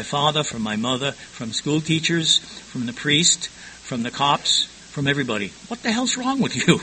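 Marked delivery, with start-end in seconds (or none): 5.7-6.8 high-pitched exasperated mock scolding; mimicked scolding. Everybody scolding him since childhood, mock exasperation.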